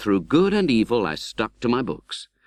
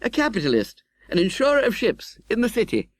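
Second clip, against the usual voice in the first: lightly voiced young gentleman, a bit higher; young friend's reply; 13 semitones higher than usual. Young friend proudly telling Pip his trade, lightly voiced. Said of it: "He replied".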